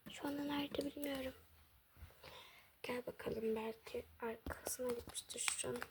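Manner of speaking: soft unvoiced whisper. Daughter and mother whispering while searching for the phone.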